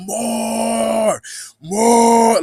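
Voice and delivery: high, long held bellow; imitated yell. Showing how it kept yelling, long loud cries. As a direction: cry it out high, holding the vowel. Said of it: "like, yelling".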